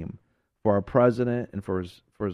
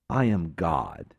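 lower, solemn divine voice; voice of God; 8 semitones lower, 3 dB quieter than usual. Acted. God rebukes Lucifer for turning the angels, low and solemn. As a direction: speak down from the throne.

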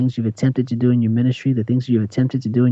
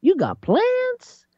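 hammed-up squeak of disbelief, much higher; mock surprised God. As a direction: play God baffled by the proud, high and squeaky. Mocking the suddenly proud.

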